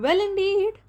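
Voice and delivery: high, loud outraged mouse cry; the mouse bursts out, high and loud; a loud, offended squeak; indignant mouse. A narrator's reading. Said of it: "cried the mouse".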